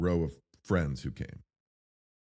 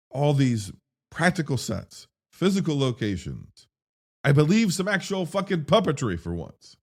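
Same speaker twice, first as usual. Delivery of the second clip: excited; 8 semitones higher, 4 dB louder than usual. Real, not acted. Enthusing about practical effects.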